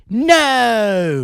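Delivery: high, loud, drawn-out British no; comic's take on a bratty rocker's bellow. Pretend. Imitating comedian's rock star bit, loud mock-British. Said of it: "That's [name] doing [name]".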